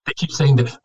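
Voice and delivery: usual speaking voice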